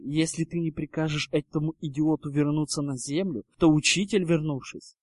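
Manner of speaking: ordinary delivery, nothing marked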